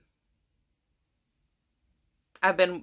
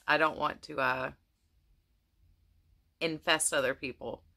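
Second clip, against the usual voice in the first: usual speaking voice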